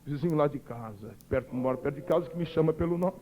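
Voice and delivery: low, soft inner voice; inner thought, murmured. Retold or imitated. Player thinking it's a neighbour calling, quietly to himself. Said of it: "olhei para a arquibancada e disse".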